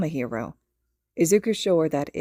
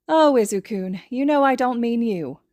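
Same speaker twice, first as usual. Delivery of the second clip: a touch higher, sugary mom voice; sweet reassurance; 5 semitones higher, 4 dB louder than usual. A narrator's reading. Mother sweetly reassures her son's friend. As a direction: soften it sweetly for the boy. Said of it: "smiles sweetly at him".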